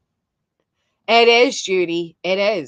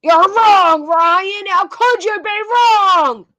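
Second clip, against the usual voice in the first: higher, louder hammed-up mock indignation; mocking outrage; 9 semitones higher, 4 dB louder, slower than usual. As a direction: parody him yelling at the host. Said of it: "look at him".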